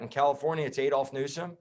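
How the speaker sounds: ordinary talk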